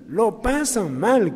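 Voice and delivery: "lo pasan mal" drawn out very slowly, leaning on "mal"; slow emphatic repeat. Real, not acted. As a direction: stretch "mal" slowly for weight.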